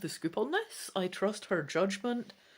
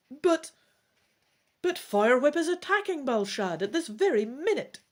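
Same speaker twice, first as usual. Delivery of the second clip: louder, higher alarmed protest; urgent cry; 7 semitones higher, 7 dB louder than usual. Acted. Rynath warning Firewhip attacks Balshad, urgent cry. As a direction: stammer out the alarm. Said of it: "Rynath cries".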